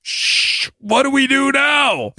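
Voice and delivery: high, drawn-out helpless wail; hammed-up cry of bewildered panic; mock panic. Host imitating flustered Democrats under attack, high mock-panicked cry. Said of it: "the Democrats are like".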